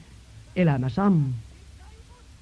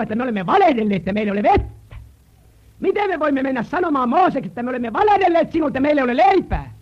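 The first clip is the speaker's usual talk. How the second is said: impassioned sermon pleading, growing louder; rising preaching; 9 semitones higher, 7 dB louder than usual. Acted. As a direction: climb the questions toward a cry. Preacher voicing leaders' shame.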